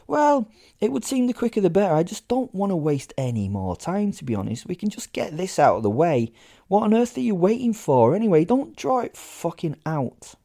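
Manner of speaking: high, loud exasperated rush; loud fed-up plea to get it over with; impatient urging. Acted. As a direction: hurry her up, fed up. Narrator impatiently urges her.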